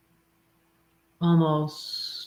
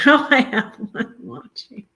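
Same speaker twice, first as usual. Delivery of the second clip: laughing; 8 semitones higher, 6 dB louder than usual. Real, not acted. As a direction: laugh through it, higher. Repeats with laugh.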